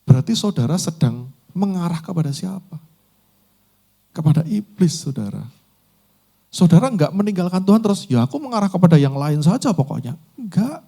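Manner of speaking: high, loud urgent sermon exhortation; full-force preaching. Acted. (khotbah berapi-api, suara tinggi dan keras)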